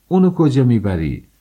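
wife shouting as he takes the shawl. Acted. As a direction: yell at him to stop. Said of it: "فریاد زدم".

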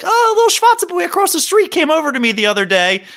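quick, high-pitched imitation of grandma; ninety-two-year-old grandmother, rattling it off. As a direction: impersonate a chattering old bubby. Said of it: "the old ninety-two-year-old is like".

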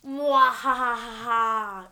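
slow evil cackle; mad scientist laugh. Acted. Villain laugh after intro.

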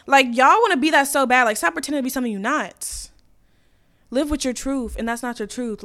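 ordinary talk

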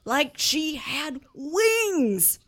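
ordinary talk

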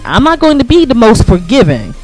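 usual speaking voice

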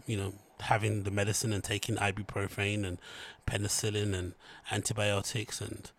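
usual speaking voice

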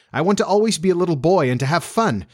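put-on high-pitched boyish insistence; passionate. A narrator's reading. Boy declaring his wish to stay little, passionate. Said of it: "he said with passion".

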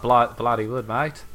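a light Hugh Jackman Aussie voice; Australian accent. Retold or imitated. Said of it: "That was a great Australian accent there".

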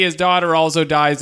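ordinary talk, nothing marked

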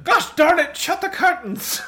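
very high hammed-up yell; angry shout. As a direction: squeal it in mock fury. Dad yelling, copied by friend.

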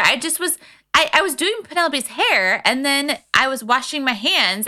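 ordinary talk, nothing marked

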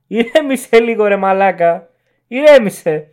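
loud, high, exasperated outburst; exasperated shout. Retold or imitated. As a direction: snap back at a ranting fanatic. Retort to the screaming zealot.

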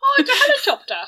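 high, laughing "oh, it's a helicopter"; laughing coo. Real, not acted. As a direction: coo over it like it's adorable. Cooing at the cute word.

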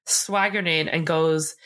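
usual speaking voice

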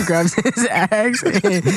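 high giggly words; laughing. Real, not acted. Player laughs while narrating the axe grab.

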